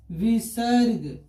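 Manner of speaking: विसर्ग drawn out very slowly, syllable by syllable; slow dictation. Shown as a demonstration. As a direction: stretch each part of विसर्ग for the class. Teacher repeats the term slowly for students.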